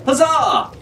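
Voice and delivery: high cheering Huzzah; exclaiming. A narrator's reading. Fitzwilliam cheers at the wind, high and excited. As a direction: cheer it out brightly. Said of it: "Fitzwilliam exclaimed".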